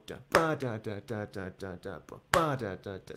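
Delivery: "ba" punched, following "da"s falling away; accented note demo. Shown as a demonstration. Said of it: "let's accent every, uh, second eighth note".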